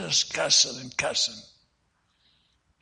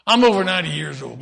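indignant outburst; 3 semitones higher, 9 dB louder, faster than usual. Retold or imitated. Old man indignant.

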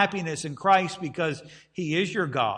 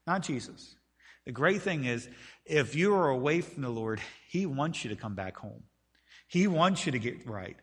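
ordinary talk

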